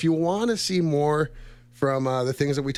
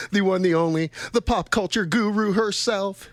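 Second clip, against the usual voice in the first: rising, higher-pitched grand introduction; announcer build-up; 7 semitones higher than usual. Pretend. Host introduces pop culture guru, mock-grand announcer. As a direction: hype her up like a ring announcer.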